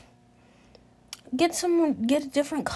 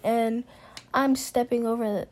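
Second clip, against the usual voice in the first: ordinary talk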